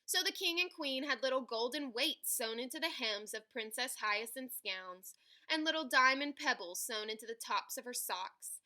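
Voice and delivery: ordinary delivery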